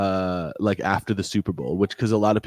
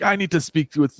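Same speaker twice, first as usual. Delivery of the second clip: higher, indignant customer voice; demanding customer; 7 semitones higher than usual. Retold or imitated. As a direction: mimic an entitled customer. Angry customer voiced in story.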